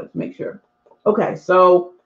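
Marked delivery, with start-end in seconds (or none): 0.0-0.6 soft unvoiced whisper to herself; a quick private whisper; whispered aside. Real, not acted. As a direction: whisper it under your breath while checking. Muttering aside while checking comments.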